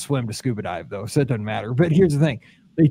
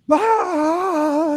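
panicked cry; 13 semitones higher, 3 dB louder than usual. Retold or imitated. Panic at bends in tank.

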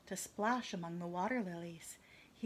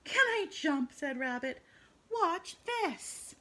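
excited rabbit; 7 semitones higher, 5 dB louder, slower than usual. Acted.

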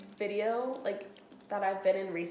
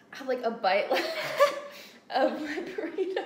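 laughing; 6 semitones higher, 5 dB louder than usual. Real, not acted. Laughing about last bite.

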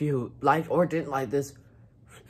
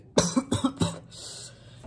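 wheezing cough; 12 semitones higher than usual. Real, not acted. Eating extremely spicy food.

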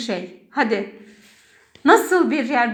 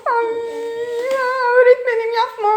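mock tickled child; 9 semitones higher, faster than usual. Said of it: "Gıdıklanıyorum".

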